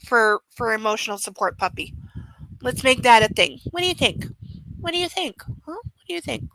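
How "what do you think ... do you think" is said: cooing to the dog. Real, not acted. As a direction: coo it gently at the sleepy pup. Cooing to her sleepy dog.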